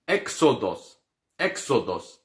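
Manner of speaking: exodos with open E and stress on the first syllable; careful Greek exodos; pronunciation demo. Shown as a demonstration. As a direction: say exodos slowly, Greek style. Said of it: "O êxodo mesmo".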